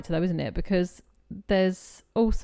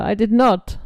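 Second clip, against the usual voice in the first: louder, mock Austrian Schwarzenegger accent; 2 semitones higher, 10 dB louder, slower than usual. Shown as a demonstration. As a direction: bark it like a little Schwarzenegger. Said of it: "You're a little Arnold Schwarzenegger".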